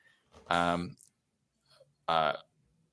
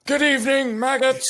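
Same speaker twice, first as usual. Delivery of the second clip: high barking drill sergeant; loud gung-ho soldier; 13 semitones higher, 5 dB louder than usual. Acted. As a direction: bark at the recruits like a drill instructor. Drill sergeant addresses recruits.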